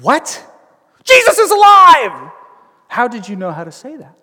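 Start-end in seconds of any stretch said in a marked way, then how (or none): 0.0-0.5 raised questioning pitch, acted disbelief; a stunned, questioning double take. Acting stunned listener hearing news. 1.0-2.3 high, loud acted proclamation; proclaiming shout.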